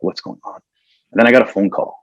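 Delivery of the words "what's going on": muttered to himself. Retold or imitated. Alone on a street corner, low.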